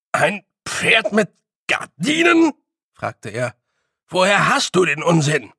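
can't believe that nonsense; baffled Feegle. A narrator's reading. Rob questioning Billy, high baffled Feegle.

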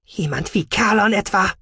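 high, affronted comeback; indignant. A narrator's reading. Scientist answers the commander's threat of replacement, indignant. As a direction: throw the question back, almost offended. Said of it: "Die Wissenschaftlerin klang empört, beinahe beleidigt".

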